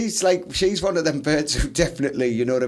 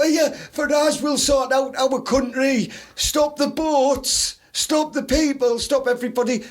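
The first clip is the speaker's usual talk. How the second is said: mock Farage fan; 7 semitones higher, slower than usual. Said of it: "they go".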